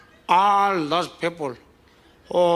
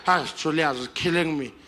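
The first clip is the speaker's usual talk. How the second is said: ordinary talk, nothing marked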